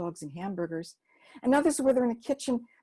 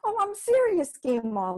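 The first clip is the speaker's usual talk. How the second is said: put-on much higher and louder, insistent girl; earnest child; 9 semitones higher, 5 dB louder than usual. Libby insisting to Gima she truly means it, high eager voice.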